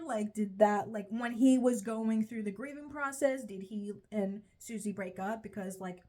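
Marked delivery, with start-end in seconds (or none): none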